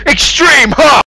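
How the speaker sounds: ordinary talk, nothing marked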